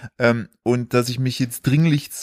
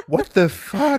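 high-pitched incredulous outburst; baffled, squeaky retold reaction; stunned disbelief; 12 semitones higher, slower than usual. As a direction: relive the shock, voice shooting up. Retold reaction to mistaken call-up.

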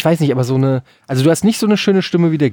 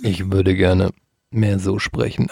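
lower, slow robotic drone; 6 semitones lower, slower than usual. Shown as a demonstration. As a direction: push the voice deep like a robot. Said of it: "Jetzt rede ich wie ein Roboter".

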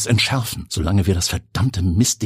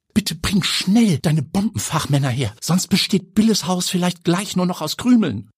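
higher, anxious woman's voice; frightened aunt on the phone; 11 semitones higher than usual. Acted. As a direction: plead for quick help. Pia ruft Till an. (ängstlich, höher am Telefon)

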